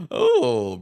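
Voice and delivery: high, loud startled oh; yelped oh; surprised oh. Real, not acted. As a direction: caught off guard by the scuffle. Reacting as players scuffle.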